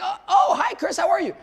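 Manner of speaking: high, loud laughing hello; excited laughing greeting; delighted surprise. Retold or imitated. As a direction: squeal with surprised joy. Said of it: "He's like".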